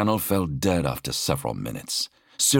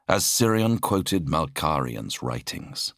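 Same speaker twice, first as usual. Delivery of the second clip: plain reading voice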